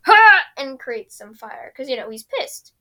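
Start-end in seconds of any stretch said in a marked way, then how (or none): 0.1-0.4 sudden loud high "Ha!"; mock angry shout. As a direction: bark it like an angry firebender. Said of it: "he does angry and goes".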